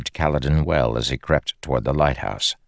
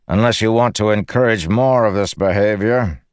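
raised, projected lordly reply; stronger voice; 6 semitones higher, 4 dB louder than usual. A narrator's reading. Local High Lord to the protesting owner, strong voice. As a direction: answer firmly and loudly across the distance. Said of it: "a stronger voice said".

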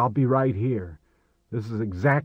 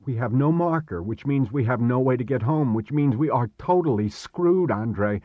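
higher, agitated and insistent; panicked outburst; 4 semitones higher, 5 dB louder than usual. Acted. Stranded Chris to Marek with no way home, panicked outburst. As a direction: rant in rising despair, hitting key words hard.

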